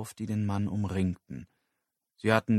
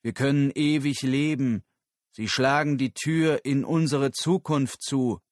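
louder, higher public-speech voice; eindringlicher Redner; 5 semitones higher, 5 dB louder than usual. Acted. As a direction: warn the crowd with force. Figur hält eindringliche Rede. (eindringliche, laute Rednerstimme)

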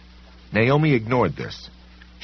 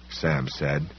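plain reading voice

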